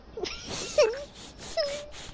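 high squealing laugh; wheezing high laughter; shrieking laugh. Real, not acted. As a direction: crack up in a piercing shriek. Laughing at blow dryer joke.

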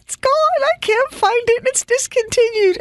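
high, crying wail; wailing in tears. Retold or imitated. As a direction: sob it out like a heartbroken child. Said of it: "I'm in tears".

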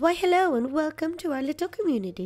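put-on high, sweet old granny voice. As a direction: play a kindly grandma welcoming a newcomer. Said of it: "the only one to make voices for".

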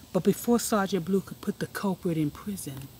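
usual speaking voice

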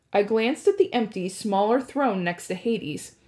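usual speaking voice

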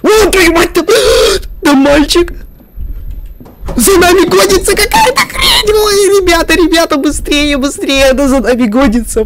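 frantic shouting while fleeing; being chased. Real, not acted. Monster chasing him in game.

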